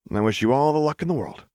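earnest well-wishing. Acted. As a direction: wish her luck from the heart. Lux wishing luck.

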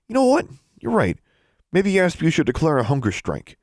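high mocking sarcasm; sarcastic. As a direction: sneer it back, pitched high. David's sarcastic retort.

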